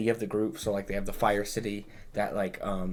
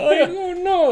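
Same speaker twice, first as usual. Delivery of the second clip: high, loud squeaky freak-out; whiny panic voice; 8 dB louder, slower than usual. Retold or imitated. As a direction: panic like a helpless little nerd. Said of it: "he's just, like, a kind of a little bitch boy".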